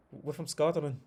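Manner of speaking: high mock Scottish accent; shitty Scottish accent. Scotsman asking where he's from in return, mock Scottish accent. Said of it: "my shitty Scottish accent".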